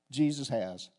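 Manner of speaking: ordinary talk, nothing marked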